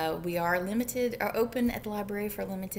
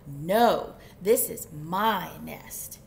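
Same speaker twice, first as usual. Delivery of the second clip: low, loud, slow retort; emphatic storybook squabble; indignant chicken; 5 semitones lower, 7 dB louder, much slower than usual. Acted. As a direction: argue back firmly, punching each word. Chicken argues back.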